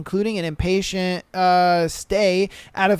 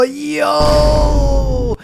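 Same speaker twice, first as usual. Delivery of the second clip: loud high yo of shock; stunned yo, shouted high; 6 semitones higher, 6 dB louder than usual. Real, not acted. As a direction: yell yo in disbelief.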